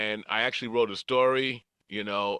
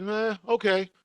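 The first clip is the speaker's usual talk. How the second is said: casual shrug; 10 semitones higher than usual. Retold or imitated. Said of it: "And I just said".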